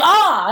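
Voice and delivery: mimicked yelp of pain, high and loud; startled yelp. Retelling her ex being poked, startled yelp. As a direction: imitate someone suddenly jabbed.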